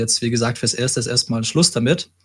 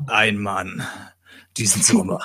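a hint of a dramatic movie trailer announcer; lightly voiced trailer narrator; epic trailer voice; 3 semitones lower than usual. Shown as a demonstration. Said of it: "diese epischen Trailer-Stimmen".

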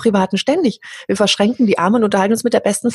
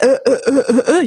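Stefan Raab's äh; 6 semitones higher than usual. Retold or imitated. Praising a TV presenter, she imitates his hesitant stammer. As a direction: toss off the "Äh" stammer like a famous host. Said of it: "ist damit berühmt geworden mit seinem „Äh".

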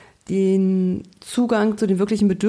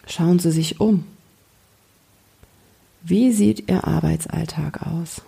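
guided meditation. Acted. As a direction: gently lead a daydream. Guides a calm visualization. (ruhige Fantasiereise-Stimme)

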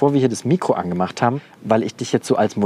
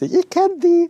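much higher, a bit louder thought; surprised recognition; 3 dB louder, much slower than usual. Retold or imitated. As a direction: squeal it like spotting a friend. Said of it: "sieht und denkt". (überraschtes Wiedererkennen, deutlich höher)

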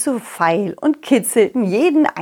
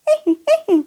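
high giggle. Acted. Narrator's character laughs high.

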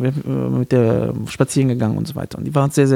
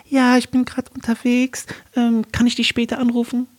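high, slowed put-on female voice; woman's voice; 9 semitones higher than usual. Man posing as his girlfriend to her caller, woman's voice. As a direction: imitate a woman on the phone, pitched right up. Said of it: "meine Stimme umgestellt in eine Frauenstimme".

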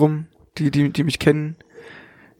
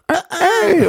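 imitated child noise. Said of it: "das Kind so".

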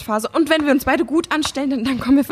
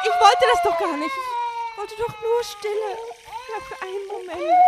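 tearful confession; 5 semitones higher than usual. Acted. Confessing, breaks into crying.

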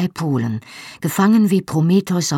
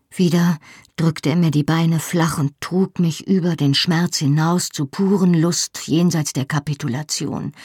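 ordinary delivery

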